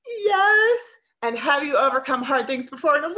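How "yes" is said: tearful yes. Retold or imitated. Tearful yes after gasp.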